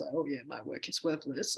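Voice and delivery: soft, deflated self-blaming murmur; mock self-pity. Researcher voicing self-blame after a harsh review, soft deflated mock self-pity. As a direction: drop the voice like you've given up on your work.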